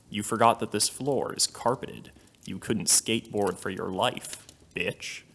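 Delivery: plain reading voice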